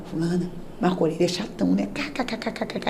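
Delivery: low hushed tease from inside the wardrobe; hushed mocking giggle. Hiding in the wardrobe teasing her cousin, hushed and giggling. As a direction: giggle it under your breath.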